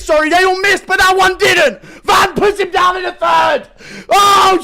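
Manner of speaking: screaming the knockdown call; excited shout. Real, not acted. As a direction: yell it at the top of your lungs.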